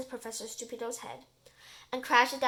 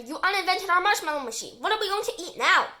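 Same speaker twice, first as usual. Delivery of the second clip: high, loud outraged complaint; angry yell; 6 semitones higher, 8 dB louder than usual. A narrator's reading. Terry blaming the professor for the lost machine, yelling angrily. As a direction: yell in indignation, pitched up. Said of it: "yells Terry".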